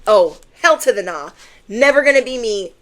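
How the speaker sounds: sassy real refusal; emphatic refusal. Real, not acted. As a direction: shut it down hard. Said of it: "emphatic refusal".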